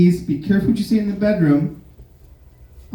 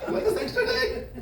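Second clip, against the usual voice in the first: high, mocking sarcastic offer; hammed-up fake surprise at her request; sarcastic falsetto mockery; sarcastic man; 10 semitones higher than usual. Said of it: "it's like".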